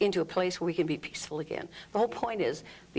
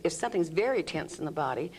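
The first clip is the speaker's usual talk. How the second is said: ordinary talk, nothing marked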